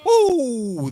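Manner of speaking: very high, very loud joyful yell; real excited whoop. Real, not acted. Streamer cheers a game result in a big whoop. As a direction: whoop at the top of your lungs over the score. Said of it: "That was a little bit too loud".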